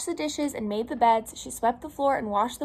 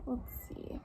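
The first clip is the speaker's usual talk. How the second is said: plain reading voice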